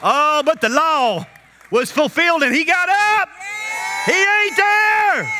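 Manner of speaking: high, loud, slow whoop; full-force preacher's cry; preaching shout. Real, not acted. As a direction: whoop it out to the church.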